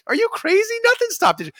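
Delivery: incredulous retort. Retold or imitated. Host answering listeners who ask if he'll quit, incredulous. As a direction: fire it back like the idea is absurd. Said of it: "I'm like".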